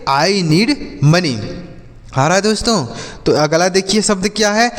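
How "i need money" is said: slow, word by word. Shown as a demonstration. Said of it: "तो ऐसे आप इसे बोलेंगे".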